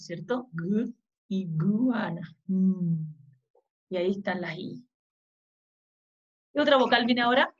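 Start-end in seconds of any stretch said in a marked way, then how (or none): 0.5-2.4 "Gu" alone, then slow "iguana" with gu picked out; new sound shown. Shown as a demonstration. Teacher showing children a new sound, "Gu, iguana" slowly sounded out. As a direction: isolate "Gu", then stretch it inside "iguana". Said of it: "Esos son sonidos nuevos".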